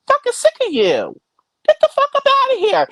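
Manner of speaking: fast, high-pitched "get out"; fed-up shout. Real, not acted. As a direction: throw them out with your voice. Ranting at rival streamers.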